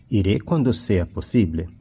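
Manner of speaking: ordinary talk, nothing marked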